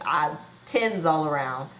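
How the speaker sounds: ordinary delivery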